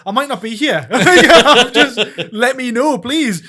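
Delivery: high, loud pleading broken by laughs; laughing mock plea. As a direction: beg her through your own laughter. Retold plea to girlfriend, laughing.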